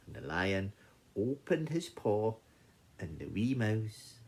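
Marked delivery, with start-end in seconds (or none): none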